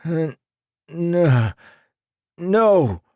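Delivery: stammer, then blurt it out in panic; panicked stammer. A narrator's reading. Hero panics as his face is about to be seen.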